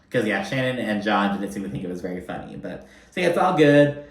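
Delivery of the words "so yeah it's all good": normal talking voice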